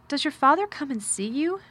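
lightly voiced girl, venturing a question. A narrator's reading. Girl asking the boy about his father, venturing. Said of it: "Mary ventured".

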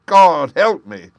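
loud, high, slow wail; anguished cry. Acted. Jem begging before Holmes, loud anguished wail. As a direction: cry it out in despair.

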